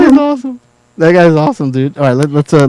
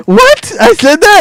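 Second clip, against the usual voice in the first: mock shock; much slower than usual. Cohost hearing her clip replayed, high mock shock. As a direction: squeal it in disbelief. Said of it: "She's like".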